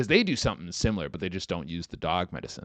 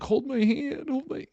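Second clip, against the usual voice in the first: much higher, frightened whine; whimpering pussy. Retold or imitated. Retelling the pepper-sprayed fake gangster begging, squeaky whine. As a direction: beg in a tiny high squeak. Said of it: "turns into the biggest pussy".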